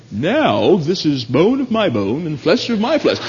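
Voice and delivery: flat, detached reading; calm, unexcited recital; cool analytical. Acted. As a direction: say it like a dry analyst. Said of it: "cool and collect and very analytical".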